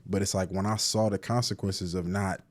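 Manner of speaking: usual speaking voice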